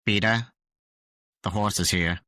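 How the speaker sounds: lightly voiced cartoon catchphrase; cartoon character impression. Impersonating a Family Guy character.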